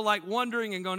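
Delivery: ordinary delivery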